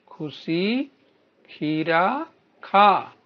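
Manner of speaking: very slow, stretching each word; slow demo reading. Shown as a demonstration. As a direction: read it slowly so the children can copy. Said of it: "आगे बोलिए बच्चों". (शब्द खींचकर धीरे पढ़ना)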